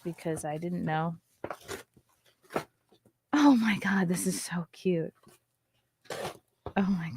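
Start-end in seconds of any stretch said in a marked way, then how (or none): 3.3-7.1 whisper. Real, not acted. Gasping at baby clothes.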